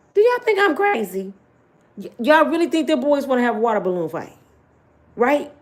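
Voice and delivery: high, loud mocking disbelief; incredulous brother. Brother scoffing at his sister's plan, loud and incredulous. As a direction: scoff at how naive they are.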